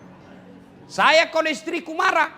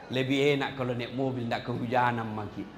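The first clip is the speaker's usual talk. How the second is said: dissatisfied man wanting more; 13 semitones lower, 5 dB quieter than usual. Retold or imitated. Greedy man wanting a car.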